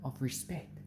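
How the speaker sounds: ordinary delivery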